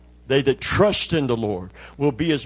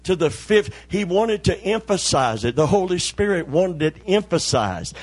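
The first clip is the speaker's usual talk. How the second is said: normal talking voice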